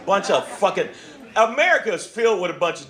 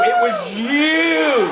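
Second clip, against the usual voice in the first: slow, drawn-out accusing shout; 5 semitones higher, 3 dB louder, much slower than usual. Acted. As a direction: stretch it out and yell it at them. Repeated slower, shouted.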